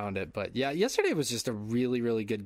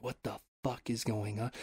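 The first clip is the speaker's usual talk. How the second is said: hushed disbelief; 2 semitones lower, 6 dB quieter than usual. Retold or imitated. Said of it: "under our masks".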